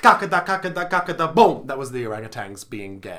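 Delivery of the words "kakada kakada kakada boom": chanting a drumbeat, loud; drum imitation. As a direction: beat it out like parade drums. Mimicking marching drumbeat.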